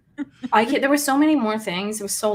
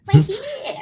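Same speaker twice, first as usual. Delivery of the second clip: whiny woman; 12 semitones higher, 5 dB louder than usual. Retold or imitated. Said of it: "quoting the woman".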